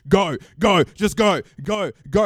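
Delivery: high, urgent waving-through; hurried customs officers. Retold or imitated. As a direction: chivvy the crowd along in short repeated commands. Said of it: "they're like".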